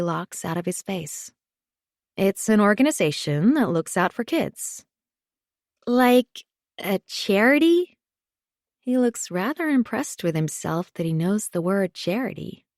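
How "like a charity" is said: put-on higher, slow child's question; little boy. A narrator's reading. Boy guessing what the organization is, childlike. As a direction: venture a guess in a small child's voice. Small boy asks his mother.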